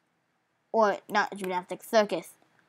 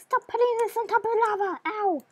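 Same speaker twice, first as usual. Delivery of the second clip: squeaky cartoon voice; 11 semitones higher than usual. Painful yelps in a high voice.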